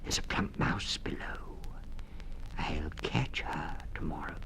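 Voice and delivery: sly whispered threat; whispering stoat. A narrator's reading. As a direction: plot the catch in a hiss-free whisper. Stoat plotting under its breath.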